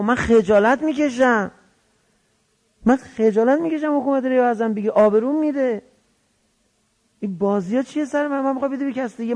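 high-pitched retold complaint; aggrieved pleading. Omar Sa'd pleads not to lose face, aggrieved. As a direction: act out an embarrassed, aggrieved protest. Acted protest at losing face.

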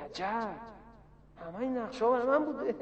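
soft, marveling inner voice; hushed amazement. Acted. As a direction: quietly marvel to yourself. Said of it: "خب ببین تو که این تاییداتو". (با شگفتی آهسته)

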